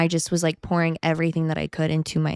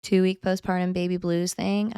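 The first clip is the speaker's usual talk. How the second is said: ordinary talk, nothing marked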